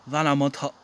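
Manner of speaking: ordinary talk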